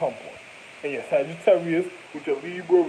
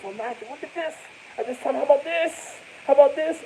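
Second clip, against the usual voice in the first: high, rising mock excitement; mock excited discovery; 10 semitones higher than usual. Mock explorers showing their find.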